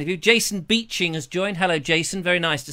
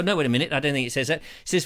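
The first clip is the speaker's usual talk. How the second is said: ordinary delivery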